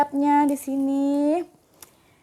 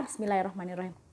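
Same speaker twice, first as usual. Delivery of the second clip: fast, much softer murmured prayer; quick quiet prayer; 7 semitones lower, 12 dB quieter, much faster than usual. Real, not acted. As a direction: rattle off the prayer softly. Quick prayer before eating.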